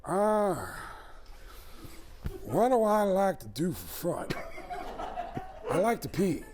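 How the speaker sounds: put-on high, slow raspy Pacino baby; high Pacino-style baby voice. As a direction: play a baby doing Pacino. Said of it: "baby sounds just like Al Pacino".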